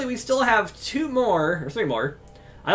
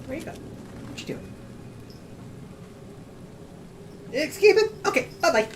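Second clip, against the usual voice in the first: higher, soft cooing pet talk; 4 semitones higher, 7 dB quieter than usual. Real, not acted. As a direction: coo gently at the cat. Talking to the cat.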